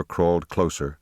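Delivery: ordinary delivery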